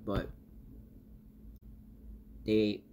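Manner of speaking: normal talking voice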